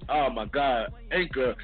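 ordinary delivery

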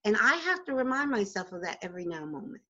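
ordinary talk

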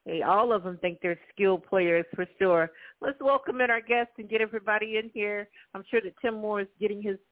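ordinary talk, nothing marked

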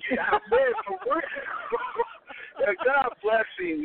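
high-pitched, laughing through words. Real, not acted. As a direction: laugh while talking.

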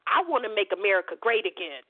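imitating a hidden admission, low and quick; muttered confession. Angry caller voicing what cowards won't admit, low and hurried. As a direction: drop low and rush through the quoted slogan.

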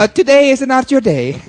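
loud, high mock Italian accent. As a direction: belt it like a theatrical Italian waiter. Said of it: "could you do this in a nice Italian voice".

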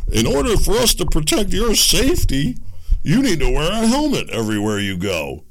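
loud, high mocking bureaucrat voice; hammed-up government scold; mock official. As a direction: sneer it like a nanny-state official.

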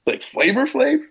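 imitating disbelief, pitch leaping high on the name; high-pitched, astonished double-check; mock incredulous question. Brother questioning his agent's casual name-drop, incredulous.

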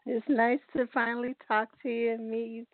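ordinary talk, nothing marked

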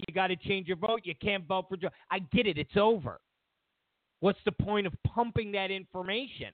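ordinary talk, nothing marked

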